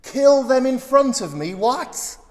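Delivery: high, loud incredulous echo; can't believe what he just read; shocked disbelief. Real, not acted. Preacher startled by the king's words, rising disbelief. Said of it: "Wait a minute".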